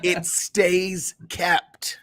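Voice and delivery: laughing while teasing. Real, not acted. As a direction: talk through a chuckle.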